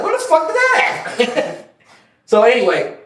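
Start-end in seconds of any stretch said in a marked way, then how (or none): 0.0-1.6 very high, drawn-out incredulous squeal; comic disbelief. Retold or imitated. Hearing from officers that he seized out, high-pitched comic disbelief. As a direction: send it way up high, slow, breaking into a laugh. Quotes his baffled reaction, laughing.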